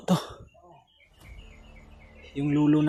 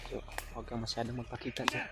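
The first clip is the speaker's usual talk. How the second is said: whispering while hiding; 15 dB quieter than usual. Real, not acted. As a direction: speak softly so nobody hears. Hiding from enemies, quiet.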